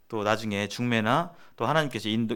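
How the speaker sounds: usual speaking voice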